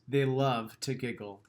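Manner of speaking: ordinary delivery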